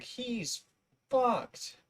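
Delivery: high, drawn-out mock quote; mock viewer quote. Viewers mocking him, high-pitched mock quote. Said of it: "they're gonna be like".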